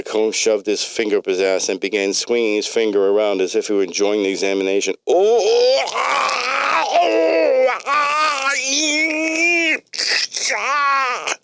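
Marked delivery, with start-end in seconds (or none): 5.1-11.3 high bellowing wails. Acted. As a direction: wail it out, high and pained. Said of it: "Luke bellowed aloud".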